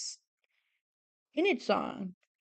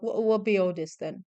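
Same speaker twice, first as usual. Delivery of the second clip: put-on upset Cockney Devon accent, lightly done; faltering Cockney Devon imitation; [name]'s Cockney Devon accent. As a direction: slip into a rough go at Cockney Devon. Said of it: "her Cockney Devon accent".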